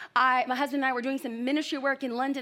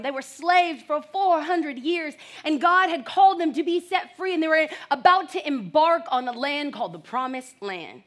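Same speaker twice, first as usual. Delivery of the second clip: ordinary talk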